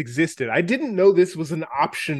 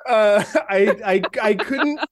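laughing; 5 semitones higher than usual. Real, not acted. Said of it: "without laughing".

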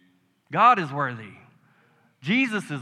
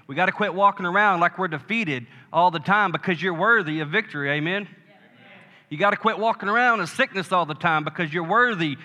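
louder, higher preaching push; sermon exhortation building up; fervent exhortation; 3 semitones higher, 4 dB louder than usual. Acted. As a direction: drive it home to the church. Exhorting the congregation.

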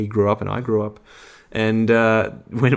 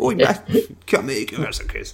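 put-on Cockney accent; 4 semitones higher than usual. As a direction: lay on a thick Cockney accent. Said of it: "some great Cockney accent".

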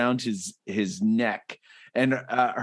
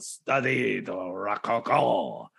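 slow, hammed-up French drawl; pompous French scholar, drawn out; silly French accent, slow and grand; mock French; 2 semitones lower, slower than usual. Mock French Rococo bit.

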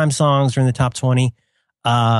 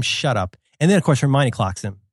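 normal talking voice

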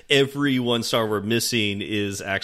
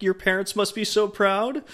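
higher-pitched, questioning mock praise; mock sarcastic; 10 semitones higher than usual. Shown as a demonstration. Host testing how a review might read, lightly sarcastic. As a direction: try out a sarcastic compliment, pitched up. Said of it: "like, a sarcastic".

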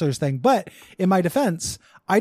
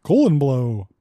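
big dumb guy voice; 3 semitones lower than usual. Pretend. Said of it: "my big dumb guy voice".

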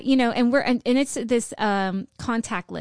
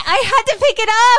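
high, loud protest; shouted self-defence; 11 semitones higher, 9 dB louder than usual. Real, not acted. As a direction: yell your excuse over a friend's outrage. Defends herself against mock accusation.

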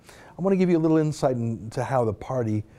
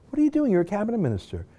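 much higher, disbelieving question; exasperated scolding; 6 semitones higher, faster than usual. Real, not acted. Host scolding a minister tweeting before the election, exasperated. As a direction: demand it in disbelief.

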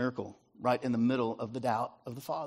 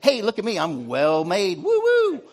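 put-on much higher, louder, gleeful child; excited toddler; 5 semitones higher, 7 dB louder, slower than usual. Three-year-old running around after bath, gleeful and much higher. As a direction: whoop like a naked three-year-old showing off. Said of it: "They're like".

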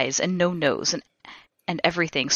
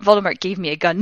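ordinary delivery, nothing marked

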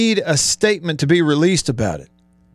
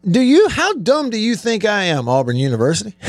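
ordinary talk

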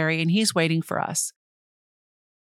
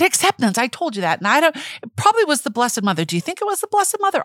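high, flustered excited babble; re-enacted breathless rush of excitement; talking a mile a minute; 7 semitones higher, faster than usual. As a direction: rattle it out, pitch climbing sharply. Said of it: "you started talking a hundred miles an hour again".

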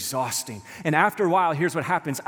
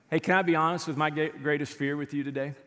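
ordinary talk

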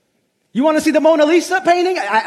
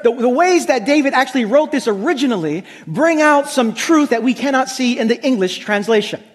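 usual speaking voice

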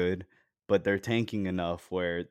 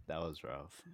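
sighing, much softer and resigned; commiserating; 3 semitones lower, 16 dB quieter than usual. Real, not acted. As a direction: sigh it out, sharing the loss. Both lament injured player.

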